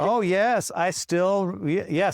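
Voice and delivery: high, loud happy exclaim; delighted. Real, not acted. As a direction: gush with real joy. Delighted the student remembers.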